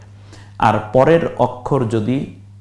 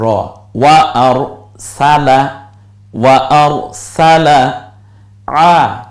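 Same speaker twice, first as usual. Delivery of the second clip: slow blended reading; 5 dB louder, much slower than usual. Shown as a demonstration. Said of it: "slow blending demo".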